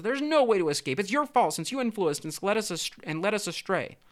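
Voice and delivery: high, loud upset complaint, no real sobs; tearful blaming. A narrator's reading. Tiny fish blaming their friend in the pouch, tearful accusation. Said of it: "began to cry".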